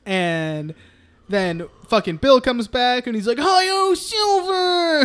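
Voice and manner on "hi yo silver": high Lone Ranger rallying cry; hammed-up heroic yell. Bill coming back on his bike, mock heroic yell. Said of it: "not the right time to yell it".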